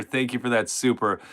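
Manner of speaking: ordinary talk, nothing marked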